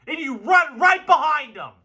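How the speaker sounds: hyped fan rant; fired up. Real, not acted. Fired up about the line.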